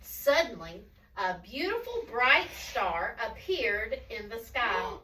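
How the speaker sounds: slow, hushed-to-wondering storybook narration; story reading to children. A narrator's reading. Telling children of the first Christmas, slow storytelling. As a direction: draw out the words, pausing for suspense.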